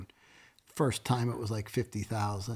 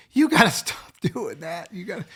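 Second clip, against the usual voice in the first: high hammed-up wife, laughing while she scolds; wife's laughing scold; 10 semitones higher, 10 dB louder than usual. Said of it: "She's like".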